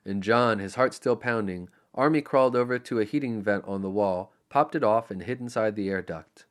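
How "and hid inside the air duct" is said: ordinary delivery, nothing marked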